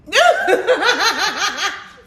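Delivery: high burst of laughter; loud laugh. Real, not acted. Laughing at cake request.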